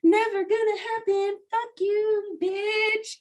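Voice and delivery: high-pitched mocking head voice; inner critic voice. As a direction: taunt in a drawn-out, singsong high voice. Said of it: "your own fucking voices in your head".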